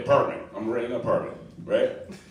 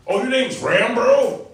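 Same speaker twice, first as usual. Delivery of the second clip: higher, louder dude-bro mockery; mock truck bro; 11 semitones higher, 5 dB louder than usual. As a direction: ham up a truck-loving bro, pitched up.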